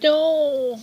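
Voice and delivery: high yelped mock no; a sudden hammed-up shriek; mock scream. Peggy attacked by a koala, mock scream.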